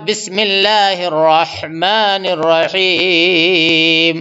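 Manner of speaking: slow, drawn-out tajweed chant; Quran recitation. Shown as a demonstration. Teacher reciting for students, slow tajweed chant. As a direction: recite it slowly and melodically.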